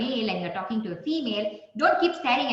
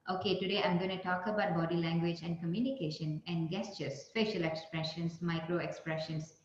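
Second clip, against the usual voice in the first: flat, lower, softer monotone drone; monotonous; 5 semitones lower, 9 dB quieter than usual. Shown as a demonstration. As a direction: drone it out dead and lifeless. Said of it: "I just talk like".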